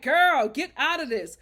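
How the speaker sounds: loud, high exasperated plea; imitating urging producer. Producer silently urging Paige to leave, loud exasperation. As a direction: push it out as an urgent mock plea. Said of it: "but she's just like".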